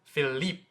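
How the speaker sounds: Philippe as fee-LEEP, short; short French Philippe; French pronunciation. Shown as a demonstration. Teacher models it for viewers, French pronunciation. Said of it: "a little bit in French".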